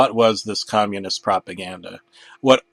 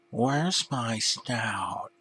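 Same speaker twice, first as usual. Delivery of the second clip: very slow, much softer; dazed and bewildered; lost mumble; 10 dB quieter, much slower than usual. Acted. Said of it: "Arthur said".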